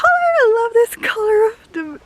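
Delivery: high, slow, gushing delight over a color. Real, not acted.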